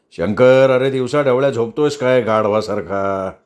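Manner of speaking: lightly voiced boss snarling at his driver; growling boss. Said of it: "गुरकवत होता". (साहेबाचं हलकंसं गुरकावणं)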